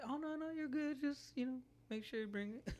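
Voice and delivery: high, sweet customer voice; sugary polite. Retold or imitated. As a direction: reassure with fake sweetness. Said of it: "And I'm like".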